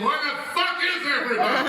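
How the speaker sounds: mock losing patience on the intercom; exasperated captain. Captain fed up after five hours.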